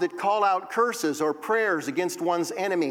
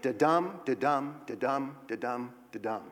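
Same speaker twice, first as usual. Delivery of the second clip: "dedum, dedum" in an even da-DUM pulse, getting softer; iambic pentameter beat; 3 semitones lower, 5 dB quieter than usual. Shown as a demonstration. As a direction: chant "dedum" as a row of iambs, each "dum" stressed. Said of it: "there are five of these iambs".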